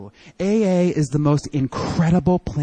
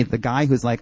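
usual speaking voice

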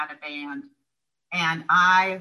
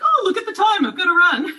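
mock sing-song excuse, much higher and louder; finta scusa frettolosa; 13 semitones higher, 7 dB louder than usual. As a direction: brightly fake a hurry to get off the phone. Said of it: "And so I was like".

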